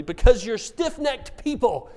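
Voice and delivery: put-on a parent borrowing God's line on the kids; parent scolding. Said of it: "start using this phrase in our family".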